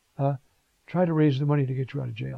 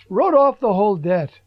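louder, proclaiming wrote off the whole debt; emphatic; 3 semitones higher, 8 dB louder than usual. Acted. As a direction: announce the release triumphantly. Climax of the parable.

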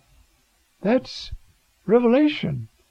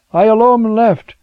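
complaining; 6 semitones higher, 9 dB louder than usual. Acted. Elijah's complaint acted out.

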